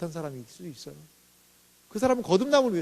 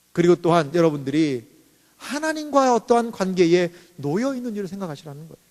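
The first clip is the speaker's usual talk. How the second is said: ordinary talk, nothing marked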